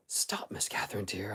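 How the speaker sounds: low, hushed nurse's check; gentle interruption. A narrator's reading. Ellen interrupts Catherine's story, gently checking her. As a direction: cut in softly and low.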